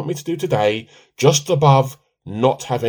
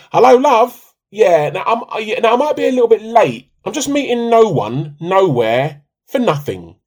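higher and louder, a sing-song fake phone voice; mocking impression of wife on phone; 8 semitones higher, 7 dB louder than usual. Husband mocks his wife's secret call in a high, loud fake voice. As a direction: lampoon her sweet telephone manner, pitched up.

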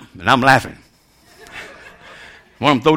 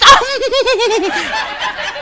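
very high, rapid giggle; imitated Wilma-like laugh; 3 dB quieter than usual. Preacher imitating Patty laughing at a joke, high giggle. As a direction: mimic her high-pitched titter. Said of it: "that girl be laughing".

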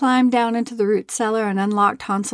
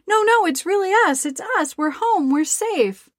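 put-on higher, urgent children's cry; 10 semitones higher than usual. A narrator's reading. Children rushing to their grieving father, crying out higher and eager. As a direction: reassure him, breathless and eager.

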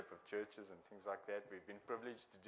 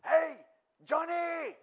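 much higher, much louder call across the flats; street preacher shout; 12 dB louder, much slower than usual. Retold or imitated. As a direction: yell up at a block of flats to a stranger. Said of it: "And he'd go and shout".

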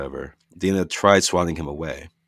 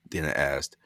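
ordinary delivery, nothing marked

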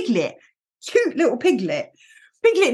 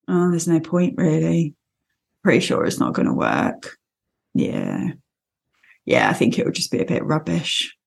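low, gloomy mope of a donkey; a hint of a glum Eeyore; dejected Eeyore; 6 semitones lower than usual. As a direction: sigh it out like nothing will work. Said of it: "That sort of just dejected".

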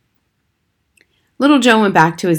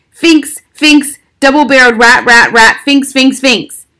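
loud, higher yelling rant; furious shouting father; 6 semitones higher, 7 dB louder than usual. Acted. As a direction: bellow it like a furious dad. Angry father storming in shouting.

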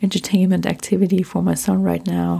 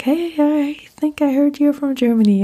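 friendly greeting; 8 semitones higher than usual. Retold or imitated. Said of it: "I was like".

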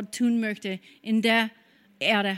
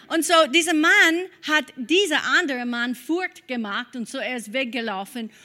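usual speaking voice

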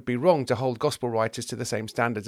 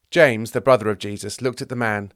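usual speaking voice

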